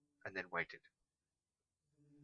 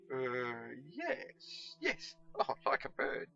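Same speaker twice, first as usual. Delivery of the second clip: humouring nervous chap; 3 semitones higher, 6 dB louder, much slower than usual. Acted. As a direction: humour the madman, nervously eager. Pickle bluffing.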